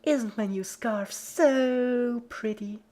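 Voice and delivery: very high, slow, drawn-out posh fawning; mock Rarity. A narrator's reading. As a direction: preen like Rarity. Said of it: "I'm Rarity".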